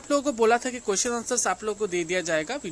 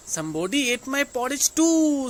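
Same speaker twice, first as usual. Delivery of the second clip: mama bear; 6 semitones higher, 6 dB louder, much slower than usual. A narrator's reading. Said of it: "said Mama Bear".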